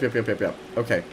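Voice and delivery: ordinary talk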